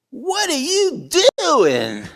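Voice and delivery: high, loud cop demand, stretched slow; suspicious cop. Retold or imitated. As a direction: bark it like a suspicious patrolman. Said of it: "the cop questions Stanley".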